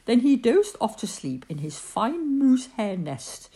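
plain reading voice

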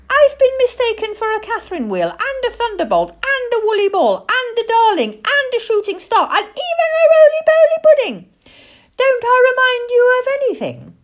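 put-on very high, loud childlike voice, indignant; little owl in piping protest; excitable baby owl. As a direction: reel off the list, building to an outraged squeal.

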